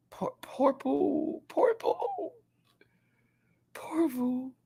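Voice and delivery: put-on high, whispered baby-talk pity; mock pity. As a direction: coo at him like a pouting baby. Mock pity for stuck contestant.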